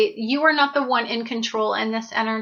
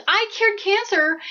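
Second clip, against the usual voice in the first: mock yell; 7 semitones higher than usual. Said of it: "you're screaming it from the mountaintops".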